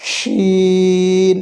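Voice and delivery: শিন with a very long vowel; long drawn-out letter. Shown as a demonstration. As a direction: hold শিন out steadily for four beats. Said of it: "চার আলিফ পরিমাণ দীর্ঘ হবে".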